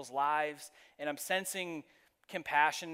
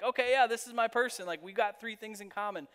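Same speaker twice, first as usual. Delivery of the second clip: pitched up high, pleased and eager; like you've just found your person; bright delighted realization, higher voice; excited recognition; 7 semitones higher, 4 dB louder than usual. Retold or imitated. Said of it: "you're sort of like".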